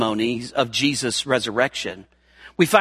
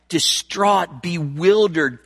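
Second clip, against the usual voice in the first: ordinary talk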